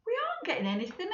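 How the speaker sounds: high, fast whine; a quick, high complaint; father complaining. Acted. Mister Large to his wife, high and fast.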